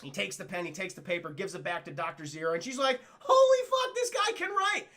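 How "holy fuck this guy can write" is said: very high, loud astonished cry; mock amazed shout. Ape doctor amazed the human can write, high loud mock shout. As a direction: shriek with delight at a discovery.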